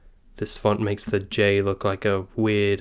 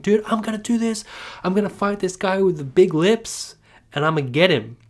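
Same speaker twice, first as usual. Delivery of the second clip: high, excited imitation of a cocky hero; hyped-up Usopp; 8 semitones higher than usual. Fan retelling Usopp's bold vow, high and pumped. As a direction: pump yourself up like a braggart about to fight. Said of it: "where he's like".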